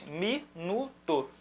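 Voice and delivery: "minuto" broken into three slow, separate syllables; scandito in sillabe. Shown as a demonstration. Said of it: "Minuto tem três sílabas".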